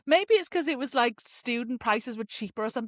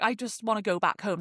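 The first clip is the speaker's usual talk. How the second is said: ordinary delivery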